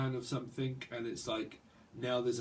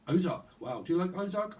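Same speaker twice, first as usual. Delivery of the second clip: excited recognition; 7 semitones higher, 5 dB louder than usual. Real, not acted. Excited about Netflix show.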